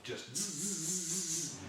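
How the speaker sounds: drawn-out high buzzing alarm noise; bite alarm buzz. Retold or imitated. To his mate about the bite, a slow high buzzing alarm imitation.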